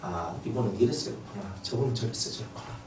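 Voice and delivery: gently resigned inner voice; understanding murmur. Retold or imitated. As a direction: murmur it with calm acceptance. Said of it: "다 이해를 했거든요".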